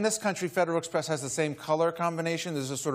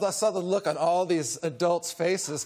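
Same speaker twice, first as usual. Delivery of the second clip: usual speaking voice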